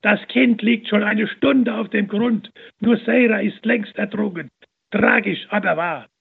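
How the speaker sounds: high, loud mocking taunt; jeering man taunting the nun; scornful jeering. A narrator's reading. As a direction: rub it in with scorn. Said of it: "schrie einer voller Hohn".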